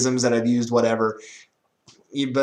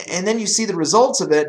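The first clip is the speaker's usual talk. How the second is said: ordinary talk, nothing marked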